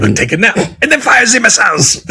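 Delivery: high, loud put-on meme voice; mock YouTube meme voice. Quoting the YouTube meme's nap-then-missiles line in a hammed-up voice. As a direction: ham up the absurd order. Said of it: "the old YouTube thing".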